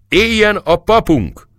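high, loud toast cry, slow; cheering shout. Acted. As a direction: raise a glass and shout it across the hall. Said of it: "kiáltott Zoltai".

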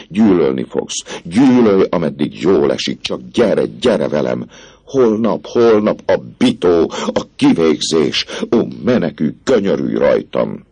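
high, loud, frantic begging; urgent cry for mercy; desperate pleading. Acted. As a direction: plead as if the gallows were already waiting. Priest begs desperately, rising panic.

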